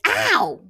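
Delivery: sharp high yelp of pain; loud mock ow of pain. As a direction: cry out Ow like it stings. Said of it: "So I'm like".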